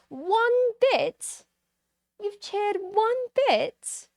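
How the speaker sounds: high-pitched, drawn-out incredulous question; mock disbelief. Streamer teasing a viewer who cheered one bit, incredulous. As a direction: squeal it slowly in playful astonishment. Mock shock at tiny donation.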